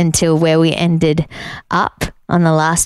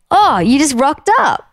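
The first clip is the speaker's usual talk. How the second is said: pitched-up surprised greeting; acted surprise; 7 semitones higher than usual. At the bar spotting a friend at the door, surprised. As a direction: greet someone who has turned up unannounced. Acts out greeting an unexpected arrival.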